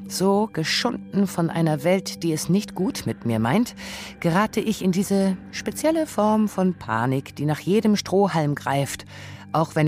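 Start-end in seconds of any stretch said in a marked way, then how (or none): none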